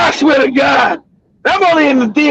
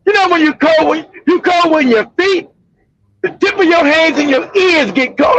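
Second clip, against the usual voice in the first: ordinary talk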